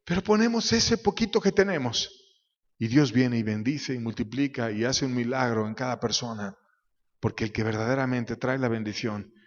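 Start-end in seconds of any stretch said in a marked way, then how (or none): none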